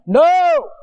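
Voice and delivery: loud, high, abrupt refusal; mock sergeant shout. Preacher as sergeant overruling a recruit, shouting. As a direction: cut him off with a shouted order.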